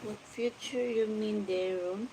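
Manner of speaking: ordinary talk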